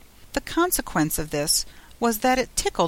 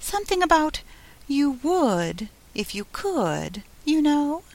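high, tiny voice at her ear; little voice; 8 semitones higher than usual. A narrator's reading. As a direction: pipe it up like a very small creature. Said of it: "said the little voice".

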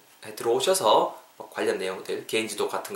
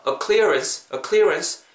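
"A clearance" with a weak "uh", pitch lifted on "clearance"; unstressed article; 6 semitones higher, much slower than usual. Shown as a demonstration. Teacher shows the weak article, stress falling on "clearance". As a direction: drop the stress on "A", hit "clearance". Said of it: "어는 강세 빼주시고요".

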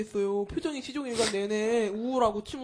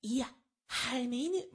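slow, softer mock period-drama voice; attempted actor imitation; 6 dB quieter, slower than usual. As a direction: botch an imitation of a strained historical-drama delivery. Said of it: "진짜 뭐 따라도 못하겠네". (사극 연기 어설프게 따라 하기)

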